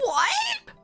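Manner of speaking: high, incredulous yelp; retold shock, pitch leaping up; shocked disbelief. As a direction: react like you can't believe it. Retold shocked reaction to friend.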